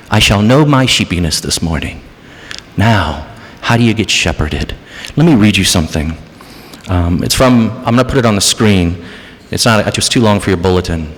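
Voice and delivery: normal talking voice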